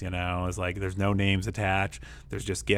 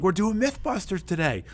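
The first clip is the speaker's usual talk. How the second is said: put-on much higher, bright announcement to a child; upbeat teacher; 12 semitones higher than usual. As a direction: sell the fun to a sad kid. Teacher cheering up upset girl.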